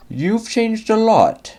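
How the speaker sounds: put-on a beaming old engine greeting his brother; smiling Flying Scotsman. A narrator's reading. Flying Scotsman greets brother Gordon, smiling and high. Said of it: "smiled the Flying Scotsman".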